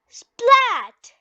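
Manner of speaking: a bright, punchy splat; splat sound effect. A narrator's reading. Reading to a child, a loud playful splat. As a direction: pop the word like mud splashing. Muddy puddle splash sound.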